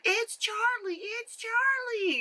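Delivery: put-on squeaky high child saying the name; high little girl voice. Vlogger imitating the girl in the movie, high child voice. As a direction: pitch way up like a small girl. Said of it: "and she's like".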